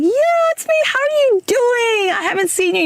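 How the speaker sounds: high, bright-pitched polite hello; mock cheerful greeting. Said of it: "doing my regular greeting".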